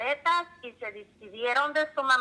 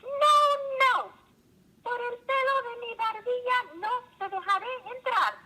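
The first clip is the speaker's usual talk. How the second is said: a tiny pig shrieking a stubborn refusal; little pig refusing; 12 semitones higher than usual. A narrator's reading. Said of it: "El cerdito gruñó y respondió".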